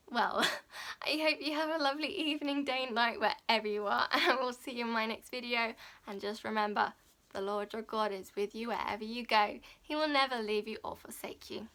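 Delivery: usual speaking voice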